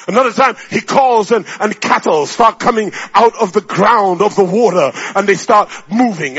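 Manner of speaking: loud, driving sermon delivery; full-force preaching. Acted. Preacher tells of creatures called out of the earth, impassioned preaching. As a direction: pile it up, punching each phrase.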